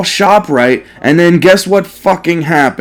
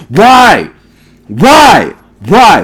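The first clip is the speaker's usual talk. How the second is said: exasperated repeated whys; 2 semitones higher, 4 dB louder, much slower than usual. Real, not acted. Fed up with ShopRite's phones.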